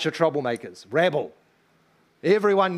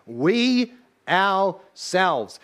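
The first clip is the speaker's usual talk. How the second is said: "We ourselves" stretched out, louder and higher; drawn-out "We ourselves" for emphasis; emphatic, very slow; 4 semitones higher, 4 dB louder, much slower than usual. Shown as a demonstration. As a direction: spell it out slowly for the whole room. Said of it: "It's emphatic".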